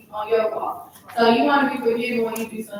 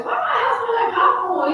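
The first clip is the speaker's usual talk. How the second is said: high-pitched mock yell of a mother; imitating mom's shouted complaint; suspicious mom; 12 semitones higher, 4 dB louder than usual. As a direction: call out an accusing question, way up high. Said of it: "my mom used to come home and say".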